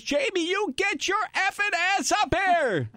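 high, mock-furious mother yelling down the stairs; angry mom yelling. Mom at the top of the stairs yells down at her son, high mock fury. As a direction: play the mom catching a kid out of bed.

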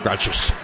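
gracias squashed into GRAH-chus; imitated mispronunciation. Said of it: "most unusual pronunciations of gracias".

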